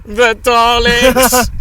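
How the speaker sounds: loud, high, drawn-out yell; mock shout. Imitating the person next to them at a pub quiz, shouting. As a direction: blurt it out loudly, stretching it right out.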